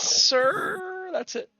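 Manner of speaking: ordinary talk, nothing marked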